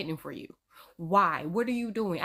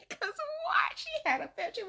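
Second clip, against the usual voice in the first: very high, softer squeaky voice; amused disbelief; 4 dB quieter than usual. Real, not acted. Reacting to the date's picture, high amused disbelief.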